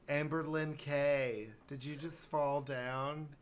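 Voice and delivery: put-on a fretful mom checking on her kids; worried parent. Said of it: "panicking parent".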